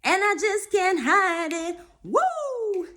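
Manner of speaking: high-pitched, giddy celebratory squeal; gleeful sing-out in a very high voice; super excited. Real, not acted. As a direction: burst with excitement, stretching words into whoops. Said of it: "I'm so excited".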